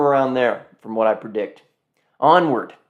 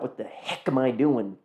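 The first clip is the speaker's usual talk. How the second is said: ordinary delivery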